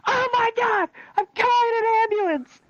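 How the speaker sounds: very high, loud mock shriek; panicked nurse. Nurse rushing to call an ambulance, hammed-up panic. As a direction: cry it out as an emergency. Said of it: "the nurse finally snaps out of it".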